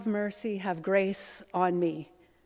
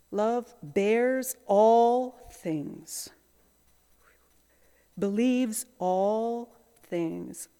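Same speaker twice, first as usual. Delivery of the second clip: reverent slow reading; much slower than usual. Shown as a demonstration.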